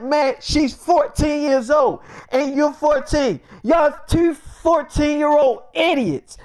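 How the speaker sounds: high, slow, drawn-out insult; retold heated put-down; exasperated outburst. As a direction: stretch each word out in high-pitched exasperation. Acted insult in a quarrel.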